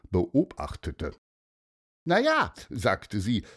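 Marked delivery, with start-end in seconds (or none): 2.0-2.5 put-on high young girl voice; lively girl. A narrator's reading. Sophia answers Pieper, high eager child voice.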